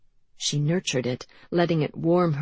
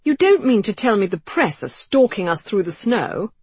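indignant man; 5 semitones higher, 7 dB louder than usual. Acted. Pompous Lacey protests.

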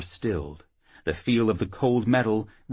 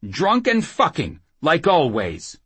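high, louder jibe; needling; 8 semitones higher, 5 dB louder than usual. Acted. Whoremaster taunting Jackal over his drinking, needling. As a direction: goad him with a scornful dig. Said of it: "Sancho needled".

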